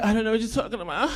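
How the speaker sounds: fast, hushed denial with a rising end. Retold or imitated. [name] repeats the denial.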